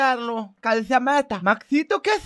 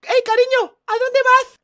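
alarmed call; 10 semitones higher than usual. Acted. Wife calls departing husband.

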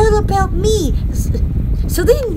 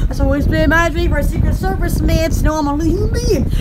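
ordinary talk, nothing marked

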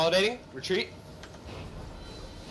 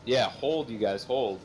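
ordinary talk, nothing marked

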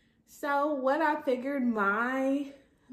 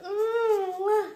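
high, loud delighted squeal; squealing ooh; 11 semitones higher, 8 dB louder than usual. Pretend. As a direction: squeal with over-the-top delight. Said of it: "I'll be like".

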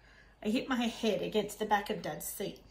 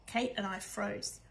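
ordinary delivery

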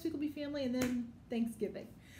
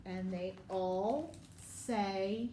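drawn-out slow lead-in, rising to invite the answer; slow prompting; 5 semitones lower, much slower than usual. Shown as a demonstration. Teacher slows down to prompt children to answer. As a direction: stretch it out so the kids jump in. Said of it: "prompting the kids".

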